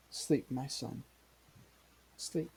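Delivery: soft, slow farewell with pauses; tearful mother. Acted. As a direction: hush a dying son, mildly grieving. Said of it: "begins to cry and says".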